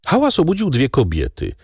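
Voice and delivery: usual speaking voice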